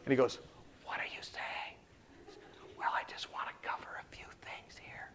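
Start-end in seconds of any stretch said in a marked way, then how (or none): 0.8-1.8 hushed questioning whisper; imitated patient's voiceless whisper; whispering back. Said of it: "Started to whisper back to me". 2.8-5.1 hushed, matter-of-fact whisper; voiceless, very soft reply. Retold or imitated. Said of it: "I would like him to whisper to me".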